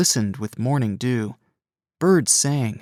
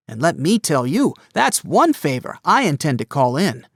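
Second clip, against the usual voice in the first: bossy cousin; 6 semitones higher, 4 dB louder than usual. A narrator's reading. Gus giving a pep talk.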